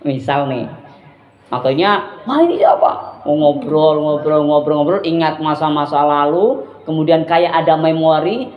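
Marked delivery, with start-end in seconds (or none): 2.3-3.2 surprised. Retold or imitated. Reading a message from an ex.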